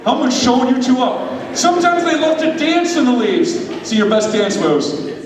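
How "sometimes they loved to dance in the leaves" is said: lively storybook narration; storytelling narration. Acted. Preacher narrating a skit to the congregation, storybook style. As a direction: tell it like a children's tale.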